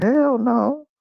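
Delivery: high squealed denial; emphatic hell no. Retold or imitated. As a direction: shoot it down hard.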